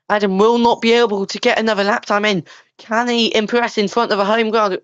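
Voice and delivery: fast live race commentary; motorsport commentator. Acted. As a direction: call the lap like Sky F1. S0 play-by-play of lap.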